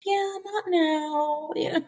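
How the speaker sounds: high, slow drawn-out "yeah, not now"; mock putting someone off; dismissive brush-off. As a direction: brush it off lazily. Imitating a hesitant answer.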